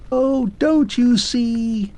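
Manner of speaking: fading away yet insisting; faint voice. Acted. Fading clockwork man insists to Arthur, faint high voice. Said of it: "the faint voice asseverated".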